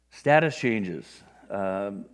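normal talking voice

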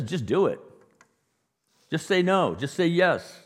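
loud, high mock preachy commands; scolding exhortation. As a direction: bark orders like a mock scold. Mimics a scolding do-better message.

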